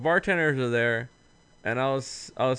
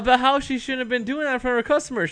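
much higher, laughing in disbelief; 11 semitones higher, 7 dB louder than usual. Retold or imitated. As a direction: call her out, half laughing. Retelling his own disbelieving retort.

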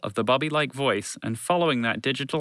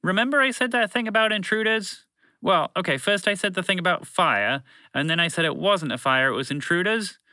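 higher-pitched, lightly voiced flustered announcer; bumbling broadcast voice; 7 semitones higher than usual. Broadcast voice correcting its own warning to the group, lightly flustered and higher. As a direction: lightly play a muddled public-address character.

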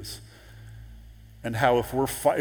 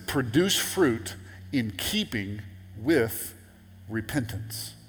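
slow scripture reading; slower than usual. Shown as a demonstration. Pastor reading the verse aloud to the congregation, slow and deliberate. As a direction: read out slowly and deliberately.

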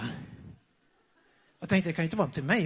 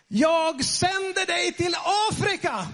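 slow, high, drawn-out bellow; booming proclamation, stretched out; retold roar; 10 semitones higher, 4 dB louder, slower than usual. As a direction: roar it out like a prophecy. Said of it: "vrålar jag till Steve".

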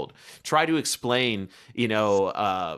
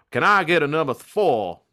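louder, lightly put-on Kentucky drawl; Kentucky accent; 4 semitones higher, 5 dB louder than usual. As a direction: imitate a friend's Kentucky order at the counter. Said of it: "hearing his accent".

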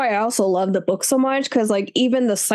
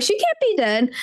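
disbelieving; 8 semitones higher than usual. Retold or imitated. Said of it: "because I'm like".

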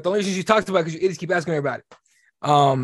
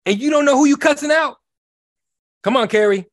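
much higher, louder outburst; real exasperated shout; 7 semitones higher, 4 dB louder than usual. Real, not acted. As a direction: yell it in disbelief. Ranting at Kari Lake.

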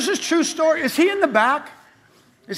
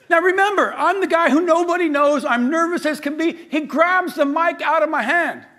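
ordinary talk

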